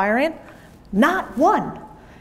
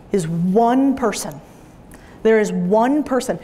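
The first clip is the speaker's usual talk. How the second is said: slow emphasis; slower than usual. Shown as a demonstration. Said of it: "slow emphatic repetition".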